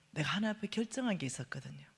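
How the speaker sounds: confiding whisper. Real, not acted. Preacher whispering a confession to the congregation. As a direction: lean in and whisper it.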